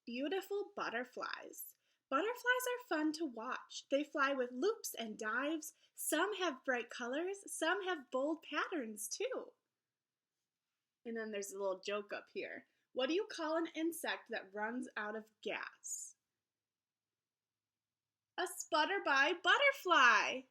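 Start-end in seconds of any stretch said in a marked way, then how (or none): 0.0-9.5 lively, sing-song picture book read-aloud; reading to children. Acted. Teacher reading a butterfly book to her class, lively storybook voice. 12.9-20.4 lively, sing-song picture book read-aloud; reading to children. Acted. Teacher reading a butterfly book to her class, lively storybook voice.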